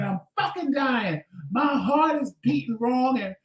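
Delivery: high, urgent demand to go to the ER; frantic plea. Retold or imitated. Calling Tramel for a ride, high frantic urgency. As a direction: panic on the phone to a friend.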